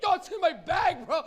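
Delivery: loud high yell, caught out; mock panicked yell; panicked shout. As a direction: blurt it out in a panic. Panicked stoner shouting about weed.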